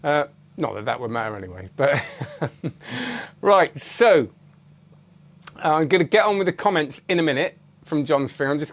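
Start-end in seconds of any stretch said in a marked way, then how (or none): 2.1-3.4 breathy laugh. Real, not acted. Laughs at own remark.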